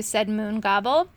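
plain reading voice

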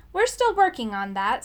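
ordinary delivery

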